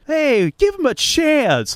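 high, slow mock yell from the stands; a cheeky fan calling out to the player; fan heckle. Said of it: "they're just yelling".

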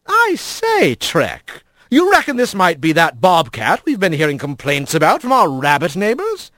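hearty otter skipper, inquiring; jovial otter mayor. Acted. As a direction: genial captain quizzing his mate. Big otter's voice.

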